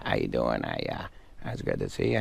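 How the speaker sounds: low, soft mumbled opening; comic impression that starts in a low mumble; Adam Sandler mumbling. Guest showing the host Sandler's first phase, mumbled impression. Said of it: "the one part where he just kinda mumbles".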